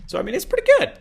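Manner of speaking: loud, very high falsetto; squeaky voice. Real, not acted. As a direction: let the voice leap up into a squeak. Squeaky voice, later called intentional cracking.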